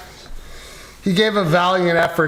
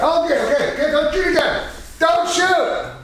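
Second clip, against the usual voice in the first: mock panic; 9 semitones higher than usual. Streamer panics about being shot.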